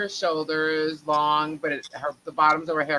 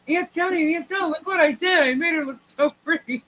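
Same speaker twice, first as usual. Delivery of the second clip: put-on much higher innocent kid voice; beaming child proud of her work; child cousin's voice; 12 semitones higher than usual. Cousin innocently shows her aunt the haircut, high child voice.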